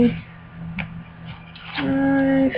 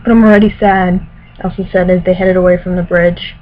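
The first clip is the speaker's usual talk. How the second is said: louder, careful novel reading voice; story narration; 5 dB louder than usual. Acted. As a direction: read it like an audiobook. Reads novel passage aloud.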